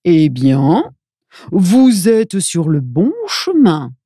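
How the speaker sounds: slow, drawn-out character voice; old fellow character. A narrator's reading. Old fellow answers the travellers about their road, slow character voice. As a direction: play a kindly fellow taking his time. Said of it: "répond le bonhomme".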